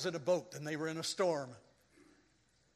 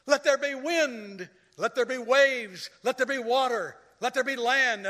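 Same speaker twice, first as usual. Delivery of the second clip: proclaiming; 7 semitones higher, 7 dB louder than usual. Retold or imitated. God speaking creation.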